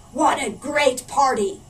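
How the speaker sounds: loud, slow pirate captain boasting. A narrator's reading. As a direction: boom it out like a gruff pirate. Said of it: "said Backbeard".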